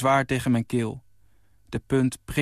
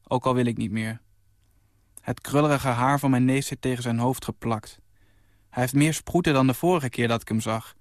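ordinary delivery

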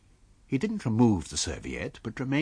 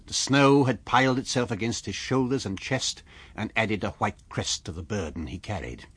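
usual speaking voice

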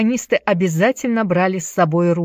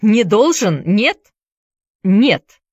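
higher-pitched, curt retort; cutting refusal; 3 semitones higher, 5 dB louder, slower than usual. A narrator's reading. Boy cutting off his friend, curt refusal. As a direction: cut him off firmly. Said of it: "отрезал Джаред".

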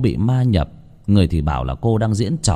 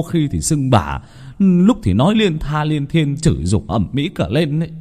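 panicked pleading; 6 semitones higher than usual. Acted. Panicked husband begs relatives.

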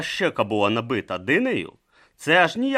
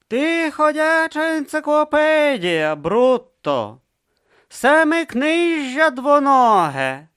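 patronizing praise; 8 dB louder, slower than usual. Acted. Vorbis patronizingly praises Brutha.

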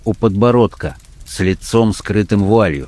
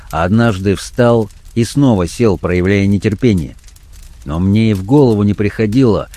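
ordinary delivery, nothing marked

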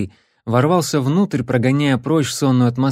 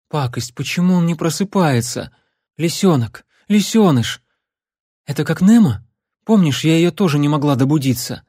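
panicked; 4 semitones higher, 3 dB louder than usual. Acted. Panicked girl voice.

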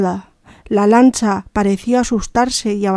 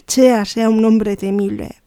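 ordinary delivery, nothing marked

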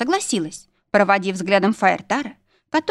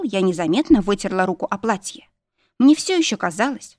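usual speaking voice

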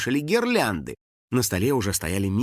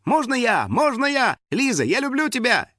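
high, eager fan cries; excited shouting; 11 semitones higher than usual. A narrator's reading. Schoolchildren begging star for a photo, excited cries. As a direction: plead to be picked, pitch soaring. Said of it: "подпрыгивать и кричать".

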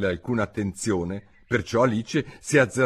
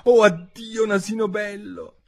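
frightened cry; 12 semitones higher than usual. Acted. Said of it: "gridò".

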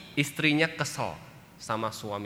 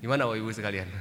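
ordinary talk

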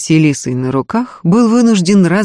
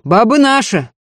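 higher, raised voice in protest; yelling; 6 semitones higher, 3 dB louder than usual. A narrator's reading. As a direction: shout indignantly, claim after claim. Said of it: "заорал Егор".

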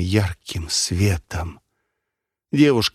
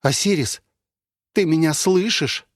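calling out; 8 semitones higher than usual. Acted. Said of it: "Валерия вновь задала вопрос".

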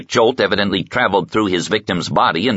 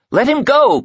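much higher, louder cry through glass; desperate cry; 4 dB louder than usual. A narrator's reading. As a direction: call out to save him. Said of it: "cried their mother".

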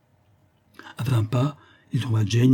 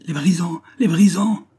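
high cry, louder the second time; cry of alarm; 9 semitones higher, 5 dB louder than usual. A narrator's reading. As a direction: cry out a terrified warning. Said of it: "s'écria-t-il dès qu'il recouvrait la parole". (cri d'alarme, aigu et montant)

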